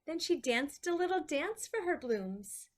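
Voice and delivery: ordinary delivery, nothing marked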